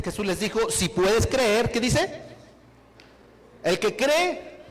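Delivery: loud, driving preacher's voice; preaching at full force. Acted. Preacher urging the church not to say I can't, preaching loud. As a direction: hammer the point home to the congregation.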